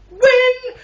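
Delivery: a sudden high, loud sarcastic cheer; mock cheer. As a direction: yell it like a fake celebration. Mock celebrating a dull finish.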